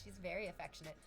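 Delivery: ordinary delivery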